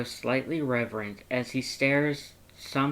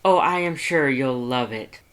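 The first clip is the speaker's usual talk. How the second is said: louder, eager and bubbly; excited phone chatter; 8 dB louder than usual. Acted. Papyrus chatting on the phone, excitedly loud. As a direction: gush down the phone with glee. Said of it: "Papyrus's excited phone conversation".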